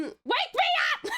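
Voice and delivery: mock alarm scream; laughing shout. Shown as a demonstration. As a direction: belt it out while laughing. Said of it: "voice pack".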